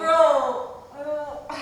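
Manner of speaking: loud, high exasperated "Bro"; fed-up friend snapping at the guy, loud. Retold or imitated.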